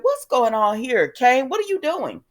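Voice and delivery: retold shock. Guests seeing Kane arrive, mock astonished. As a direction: act out rising disbelief and demand an explanation. Guests confront Kane's reveal.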